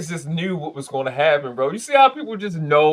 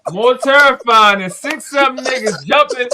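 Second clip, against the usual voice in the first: higher and louder, worked up and laughing-scared; half-shouting how scary it looked, pitch up high; telling his friend he'd be out of there, excited and loud; excited, alarmed; 8 semitones higher, 5 dB louder than usual. Real, not acted. Terrified by crew running out.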